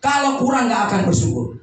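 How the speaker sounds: forceful, high sermon voice; preaching. Real, not acted. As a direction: proclaim it at full force to the congregation. (khotbah berapi-api)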